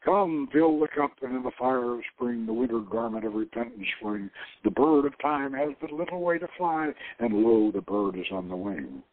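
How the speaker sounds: half-playful verse recital; poem recital. Shown as a demonstration. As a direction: recite it like a seduction line. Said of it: "I tried to seduce co-eds with it".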